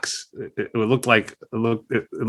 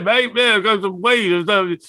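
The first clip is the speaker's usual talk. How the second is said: Bon impression; 8 semitones higher, slower than usual. Voicing Bon offering weed.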